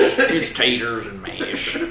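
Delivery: slow, hammed-up southern drawl on "taters"; mock Sling Blade drawl. Teasing a friend's slip, a slow hammed-up Sling Blade drawl. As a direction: stretch "It's taters and mash" lazily like Sling Blade. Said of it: "Fucking Sling Blade over here".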